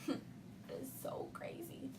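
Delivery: whispered with a little laugh; hushed, half-laughing whisper. Real, not acted. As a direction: breathe it out like a secret. Spooky turn in ghost story.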